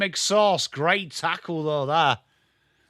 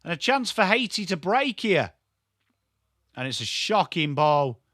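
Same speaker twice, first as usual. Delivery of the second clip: ordinary talk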